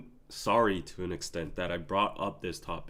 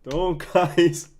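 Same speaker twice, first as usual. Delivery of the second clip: laughing plea; 8 semitones higher, 9 dB louder, much slower than usual. Real, not acted.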